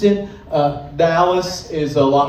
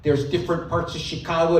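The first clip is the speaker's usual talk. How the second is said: usual speaking voice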